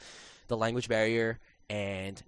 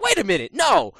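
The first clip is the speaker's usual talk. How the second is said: high, loud sudden refusal; defiant outburst; 12 semitones higher, 10 dB louder than usual. Retold or imitated. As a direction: burst out in defiance. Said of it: "I'm like".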